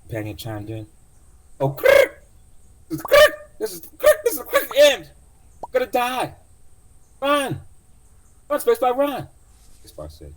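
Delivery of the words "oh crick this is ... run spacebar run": shrieking, very high and loud robot panic; panicking AI. Acted. AI companion panicking at the trapped passenger, shrill loud shriek. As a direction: scream it like a terrified AI. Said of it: "Cursor, his A I companion chimed in".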